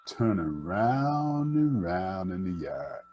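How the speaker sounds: ordinary delivery, nothing marked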